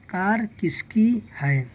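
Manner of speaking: slow, deliberate read-aloud; slow reading demo. Shown as a demonstration. Teacher modelling the finished sentence, slowly. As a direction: read the whole line slowly like a model for a child. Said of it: "काफ और सिन से स किस".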